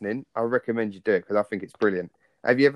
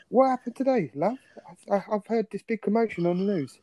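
high, exaggerated concerned voice; mock worried partner; 10 semitones higher, 4 dB quieter than usual. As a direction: ask it like a fussing spouse. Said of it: "Can you imagine?".

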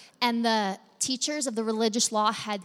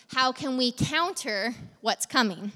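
ordinary delivery

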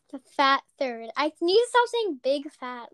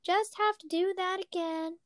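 mock doctor voice; 5 semitones higher, 6 dB quieter than usual. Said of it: "He didn't sound like that".

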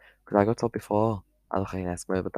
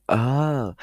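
higher, louder mock "oh" of remembering; acted sudden realisation; 6 semitones higher, 6 dB louder than usual. Showing viewers how remembering a forgotten topic will sound, mock realisation. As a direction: act out a thought suddenly coming back. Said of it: "I'll just go".

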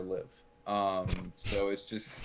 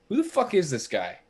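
high-pitched indignant rant; pissed off; 5 semitones higher, 4 dB louder than usual. Acted. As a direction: rant in outrage about some intruder. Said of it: "I was very pissed at the way he was acting".

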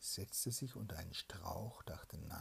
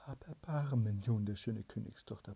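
tearful plea; 8 dB louder than usual. Acted. Soldier begging the princess for mercy, loud plea. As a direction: beg for your life. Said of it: "bat der Soldat mit Tränen".

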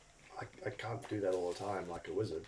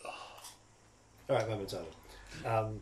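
ordinary delivery

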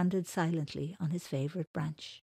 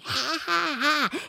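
mock angry laugh; 9 semitones higher, 7 dB louder, slower than usual. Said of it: "he's laughing".

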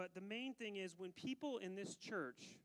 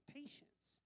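whispered; 7 semitones higher, 11 dB quieter than usual. Real, not acted.

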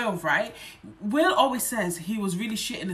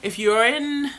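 put-on Scottish accent; 4 semitones higher, 6 dB louder, slower than usual. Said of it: "I'm trying to do a Scottish accent".

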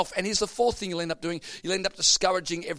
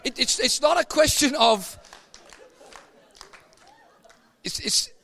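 high, loud preaching; 9 semitones higher, 7 dB louder than usual. Acted. Preacher pressing the point at full force. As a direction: drive the point home to the church.

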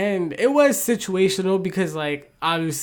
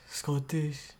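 quiet attempt at a Scottish accent; mock Scottish accent; 3 semitones lower, 11 dB quieter than usual. Cohost asked to say Scottish, trying a quiet mock Scottish accent. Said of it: "Say Scottish".

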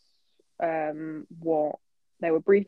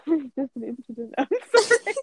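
high giggling apology; cracking up mid-sentence; laughing; 6 semitones higher, 4 dB louder than usual. Real, not acted. Giggling fit continues.

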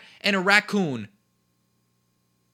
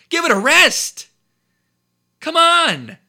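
louder and higher, fed up; fed-up outburst; exasperated shout; 8 semitones higher, 6 dB louder, slower than usual. Real, not acted. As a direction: yell it at the media.

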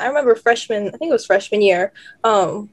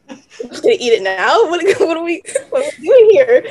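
laughing; 5 semitones higher, 4 dB louder than usual. Real, not acted. Friends surprise her with cake.

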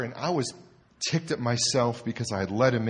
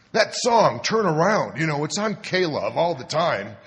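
mock eager drunk; 5 semitones higher, 5 dB louder than usual. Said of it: "Joe explains innocently".

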